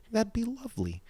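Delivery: very high, soft, prim lovely; drunk lady voice. Retold or imitated. Imitating the drunk lady accepting a sandwich. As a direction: mimic her dainty acceptance.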